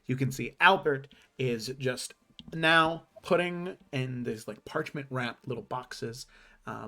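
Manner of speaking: ordinary talk, nothing marked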